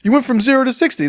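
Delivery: high mock falsetto. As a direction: squeak the accusation back. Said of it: "I was like".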